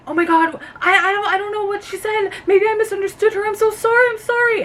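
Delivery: high, fast, panicky apologizing; flustered Catherine. Retold or imitated. Imitating Catherine cornered by two men, high and panicky. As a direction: imitate a flustered houseguest stumbling over her apologies. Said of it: "getting real frustrated and like".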